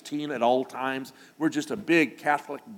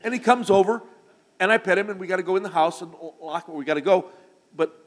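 ordinary talk, nothing marked

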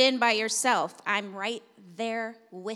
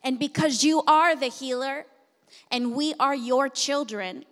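slow, rising, louder preaching prayer; fervent prayer; 5 dB louder than usual. Acted. Pastor praying aloud over the congregation, building fervor. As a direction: pray over the room with growing force.

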